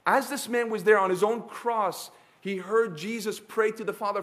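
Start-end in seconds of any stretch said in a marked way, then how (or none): none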